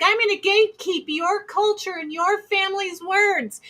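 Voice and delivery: high, put-on scolding voice; exaggerated mock busybody; mocking gatekeeper. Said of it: "because someone's like".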